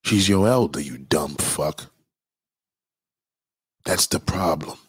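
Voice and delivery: ordinary talk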